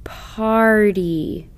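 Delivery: Party stretched slowly, sound by sound; drawn-out Party. Shown as a demonstration. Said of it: "The review word should be read like this".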